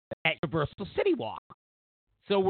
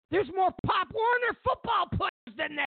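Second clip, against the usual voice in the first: incredulous shout. Real, not acted. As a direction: yell it out in disbelief. Incredulous outburst at tiny crowd.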